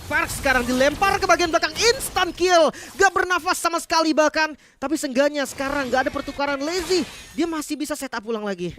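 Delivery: hyped play-by-play shout; esports caster. Real, not acted. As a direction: call the kill loud and high.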